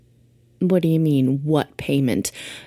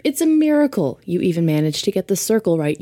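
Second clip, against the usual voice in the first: usual speaking voice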